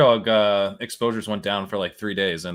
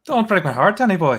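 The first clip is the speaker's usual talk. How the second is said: hammed-up Irish accent; 10 semitones higher, 7 dB louder than usual. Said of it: "That's a little Irish accent".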